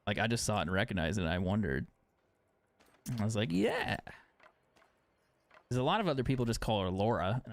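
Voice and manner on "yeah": a single high, dry mock yeah; sarcastic yeah. Sarcastic reply to the vet.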